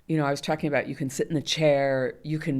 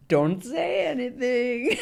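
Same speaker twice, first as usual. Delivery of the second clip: laughing; 9 semitones higher, much slower than usual. Real, not acted. Laughs at her own advice.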